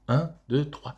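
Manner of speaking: "Un, deux, trois" run together briskly; quick count. Acted. Pupil counting on fingers for the teacher, quickly.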